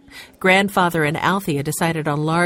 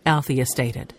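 plain reading voice

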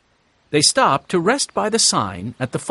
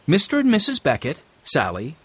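ordinary delivery, nothing marked

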